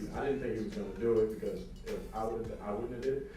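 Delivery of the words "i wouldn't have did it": ordinary talk, nothing marked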